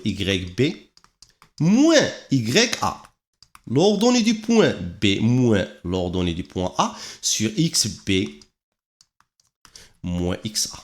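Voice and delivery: slow, word-by-word dictation pace. Acted. Teacher dictating the slope formula to students, slowly and evenly. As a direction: spell the formula out slowly for notes.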